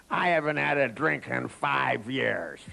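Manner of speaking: put-on slow, slurred drunk denial; drunk man. Drunk man denying drink to the security guard, slurred and slow. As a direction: insist slowly, as if plastered. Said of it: "You've had too much to drink".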